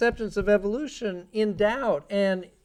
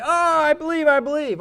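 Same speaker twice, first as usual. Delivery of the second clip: mock convert; 6 semitones higher than usual. Mocking a convinced listener.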